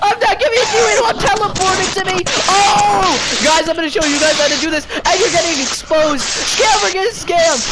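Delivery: loud, high-pitched triumphant shouting; gloating shout. Real, not acted. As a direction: crow over a scammer you just tricked. Gloating after scamming the scammer.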